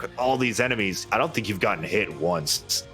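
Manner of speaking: usual speaking voice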